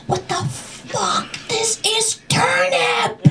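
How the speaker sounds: very high, slow, strained mock wail; outraged inner cry. Child silently panicking over turnip, high strained outrage. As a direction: squeal it out in drawn-out comic horror. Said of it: "I know I was thinking".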